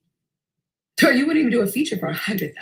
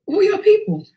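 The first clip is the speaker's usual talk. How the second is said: pressing stranger; 11 semitones higher, 4 dB louder than usual. Retold or imitated.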